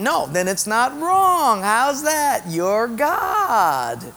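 high, stretched, goading reassurance; tempting devil. Acted. Devil telling Eve she decides right and wrong, coaxing. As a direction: draw it out high to win her over. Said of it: "what the devil was saying".

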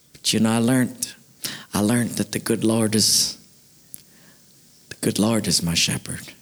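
low, hushed-paced country testimony with long pauses; deep-pitched, heartfelt rustic voice; old farmer moved. Acted. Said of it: "something came over him".

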